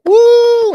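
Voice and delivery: loud, high cheering "woo"; excited whoop. Retold or imitated. Whole family cheering his failure, loud excited whoop. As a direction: whoop like a hyped-up crowd. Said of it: "they're all hyped and excited".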